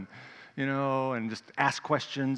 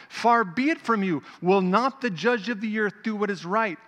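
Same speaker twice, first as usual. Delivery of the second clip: raised, insistent plea to God; Abraham pressing God to spare the city, raised and pleading; 7 semitones higher, 5 dB louder than usual. Acted. Abraham bargaining with the Lord for the righteous, loud pleading.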